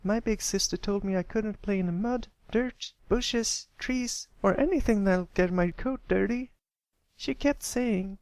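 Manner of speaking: put-on high, girlish filly voice; a little sister complaining about her rules; young filly. A narrator's reading. Narrator voices filly Sweetie Belle.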